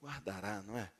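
very soft, low, easy assurance; gentle reading. Shown as a demonstration. Preacher gives the other people's reading, softly gentle. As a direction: say it lightly and tenderly, as natural. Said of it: "E tem gente que diz".

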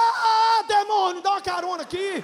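loud, high yell for a ride; shouted plea. Retold or imitated. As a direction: call out over the storm. Irmão gritando pedindo carona. (grito alto pedindo carona)

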